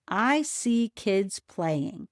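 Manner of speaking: slow, word by word; slowly. Shown as a demonstration. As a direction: say each word slowly and clearly. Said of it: "say the sentence slowly".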